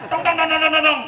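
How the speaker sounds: high, rapid-fire scolding nonsense syllables; mock angry nagging. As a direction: imitate a wife's angry rant on one syllable. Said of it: "Mama, marah dulu".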